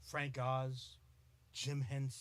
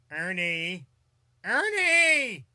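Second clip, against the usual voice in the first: Bert; 2 semitones higher, 14 dB louder, much slower than usual. Acted. Host imitating a Sesame Street puppet, rising shouted call. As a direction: call out for Ernie, building much louder. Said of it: "the original voice of Bert".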